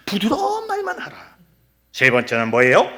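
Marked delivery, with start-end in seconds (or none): 0.1-1.4 high, softer, sweet put-on voice; mock soft-spoken. People demanding only soft words, mock-gentle high voice. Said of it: "부드럽고 이런 거 잘 못해요".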